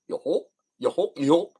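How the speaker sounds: lightly voiced Goofy, a bit goofy and loose; a hint of Goofy's dopey call; half-hearted Goofy try. Shown as a demonstration. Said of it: "can I still do Goofy's voice".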